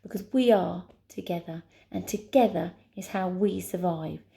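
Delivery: usual speaking voice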